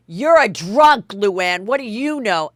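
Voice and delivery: put-on loud, slow drunken jab; hammed-up drunken taunt; drunk accusation. Dorinda drunkenly accusing Luann, loud mock taunt. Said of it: "And Dorinda says".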